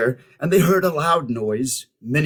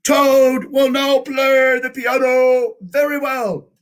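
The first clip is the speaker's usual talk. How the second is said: much higher and louder, grand showman proclaiming; strange announcer voice; 11 semitones higher, 5 dB louder than usual. Acted. A strange voice presents Toad to the theater, grandly announcing. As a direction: boom it out like a circus ringmaster. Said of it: "said the strange voice".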